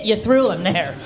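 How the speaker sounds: usual speaking voice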